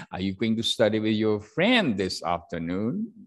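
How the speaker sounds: rising question tune; rising intonation. Shown as a demonstration. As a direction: question rising at the end on friend and afternoon. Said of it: "So rising intonation".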